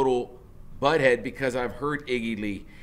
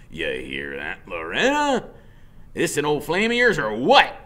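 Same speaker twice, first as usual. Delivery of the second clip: louder, rising jeering call; mocking biker; 4 semitones higher, 7 dB louder than usual. Biker laughing at the boy to his woman. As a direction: tease her across the doorway. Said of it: "and he goes".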